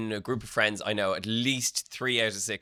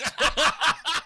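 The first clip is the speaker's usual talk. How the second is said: high laugh; 14 semitones higher, 5 dB louder than usual. Real, not acted. Cohost laughs at nose joke.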